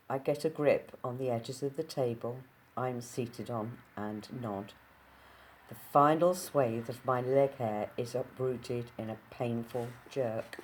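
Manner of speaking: ordinary delivery, nothing marked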